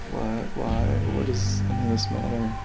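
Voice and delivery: ordinary talk, nothing marked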